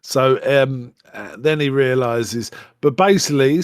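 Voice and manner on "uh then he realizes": ordinary talk, nothing marked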